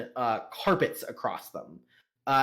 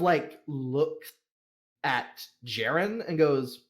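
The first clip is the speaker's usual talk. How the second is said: usual speaking voice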